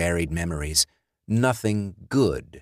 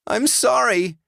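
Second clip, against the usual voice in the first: much higher, pleading, near tears; tearful Alice; 4 dB louder than usual. Acted. Alice refusing her dead ex, high tearful pleading. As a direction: plead through rising tears.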